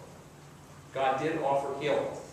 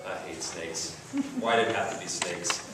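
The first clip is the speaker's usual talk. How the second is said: Indiana Jones; 9 semitones lower, 4 dB quieter than usual. Retold or imitated. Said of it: "Indiana Jones goes".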